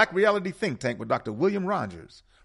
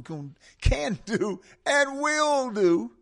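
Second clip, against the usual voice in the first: "will" drawn out much higher and louder, slow; emphasis on will; 6 dB louder, much slower than usual. Shown as a demonstration. Host urging listeners to act, stressing "will". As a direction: lean hard and slow into "will do". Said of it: "I always put emphasis on the word will".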